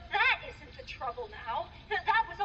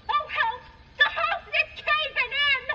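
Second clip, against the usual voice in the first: yelling for help; 12 semitones higher, 9 dB louder than usual. Retold or imitated. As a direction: shriek it like the housekeeper in panic. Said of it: "Sally yelled out".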